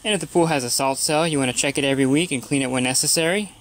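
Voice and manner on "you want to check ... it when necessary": usual speaking voice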